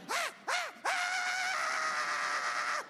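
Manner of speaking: high, hammed-up screams, last one drawn out; child's panicked screams. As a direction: shriek in bursts like a hysterical kid. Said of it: "he loses his shit".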